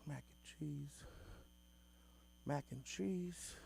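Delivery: soft musing, the second time drawn out slowly; quiet pondering. Acted. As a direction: read it softly to yourself, puzzling it over. Reads menu, pondering aloud.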